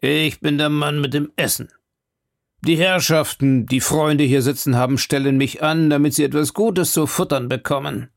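a fawning jailhouse cook talking himself up; eager grub-man. Acted.

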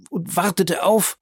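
high-pitched, breaking off unfinished; horrified faltering. A narrator's reading. Bertuccio cannot name whom she awaited, horrified. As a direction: falter as horror takes over.

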